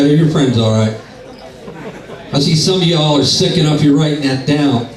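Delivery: ordinary talk, nothing marked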